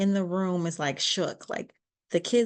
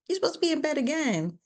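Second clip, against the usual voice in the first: much higher, fast irritated scold; exasperated scolding; 10 semitones higher, much faster than usual. Retold or imitated. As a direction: snap at the kid, fast and high. Mother scolds sleepwalking son.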